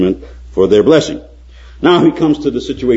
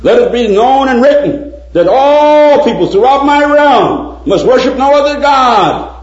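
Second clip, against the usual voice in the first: high, loud, slow royal decree; king's formal proclamation; 14 semitones higher, 6 dB louder than usual. Acted. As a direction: announce it like a herald for the king. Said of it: "he sent out a new proclamation".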